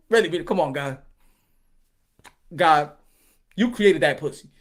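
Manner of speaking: ordinary talk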